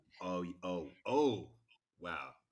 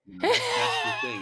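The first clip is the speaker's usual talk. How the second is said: high squeal; 14 dB louder than usual. Real, not acted. Sudden squeal about going posh.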